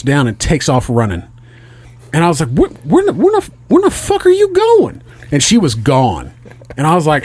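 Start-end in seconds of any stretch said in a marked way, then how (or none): 2.5-5.0 high-pitched stammering disbelief; baffled alarm. Retold or imitated. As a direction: splutter it, voice shooting way up. Acts out alarm as wife runs off.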